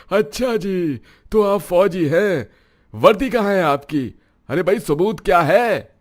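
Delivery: high, loud taunting, slowed at first; mocking sneer. [name] mocks the major's claim, loud and high. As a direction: sneer and taunt, daring him to prove it.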